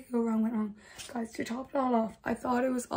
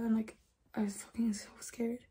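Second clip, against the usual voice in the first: whispered; 9 dB quieter than usual. Retold or imitated. Whispered end of story.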